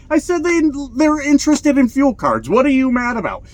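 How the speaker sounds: high, disbelieving retort to critics; exasperated defence. Real, not acted. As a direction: throw it back at the grumps in disbelief. Answers imagined angry critics.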